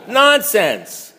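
"Nonsense" said through a laugh, drawn out. Real, not acted. Still amused, repeating the book's verdict laughing. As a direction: chuckle the word out.

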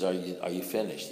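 soft, low, patient question; calm sponsor. Retold or imitated. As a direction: ask it quietly, like a calm sponsor. Sponsor voiced calmly.

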